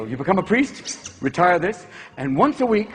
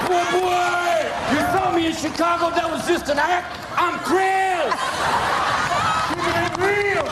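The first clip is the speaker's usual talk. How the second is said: mock street voice; 9 semitones higher, slower than usual. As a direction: play a cocky hip guy, up high. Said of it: "gonna be elected and immediately go".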